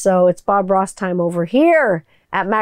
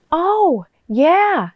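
high-pitched actress impression; Jennifer Aniston; 8 semitones higher than usual. Shown as a demonstration. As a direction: lift it high, let each word swoop. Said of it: "That's Jennifer An-, Aniston".

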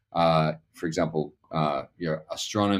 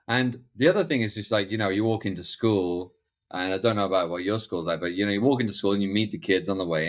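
usual speaking voice

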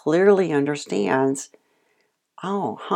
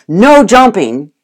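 loud, slow no jumping; stern command; 5 semitones higher, 11 dB louder, slower than usual. Retold or imitated. Owner loudly commanding the dog to stop jumping. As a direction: mimic the owner's scolding command. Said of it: "if you go".

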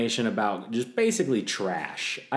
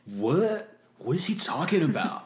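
gasp, then high put-on disbelief; mock shocked boyfriend; 7 semitones higher than usual. Boyfriend overhearing the confession, mock shocked. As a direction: act scandalised and baffled.

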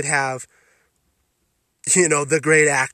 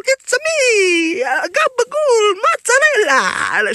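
much higher, louder, slow cartoon Italian whine; mock Italian accent; 8 dB louder, slower than usual. Mocking Leto with an over-the-top, high, squealing Italian accent. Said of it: "garbage Italian accent".

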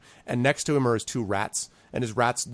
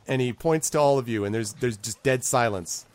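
ordinary delivery

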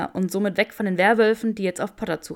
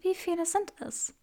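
higher, soft and feeble; weak voice; 11 semitones higher, 7 dB quieter than usual. A narrator's reading. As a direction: ask it faintly. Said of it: "mit schwacher Stimme".

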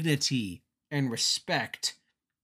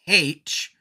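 put-on English accent; 3 semitones higher, 6 dB louder than usual. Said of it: "is that an accent thing".

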